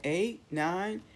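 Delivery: usual speaking voice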